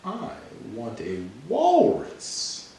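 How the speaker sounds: high-pitched cartoon pigeon, slowly drawn out; pigeon changing its mind. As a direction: read it as a high storybook pigeon, stretching words. Reads the pigeon's lines in the story.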